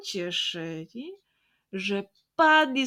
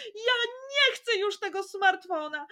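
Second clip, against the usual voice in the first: tearful refusal; 12 semitones higher than usual. Acted. Jasiek refusing the phone, high tearful plea. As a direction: say "nie" through tears, not yet shouting. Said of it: "krzyknął rozżalony Jasiek".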